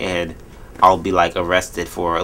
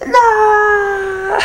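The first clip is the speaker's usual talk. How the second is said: high wailing no much louder; mock anguished cry; 6 dB louder, much slower than usual. Himself reacting to a cancer diagnosis, hammed-up anguished wail. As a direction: wail it like hearing terrible news, hammed up. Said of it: "And I'll be like".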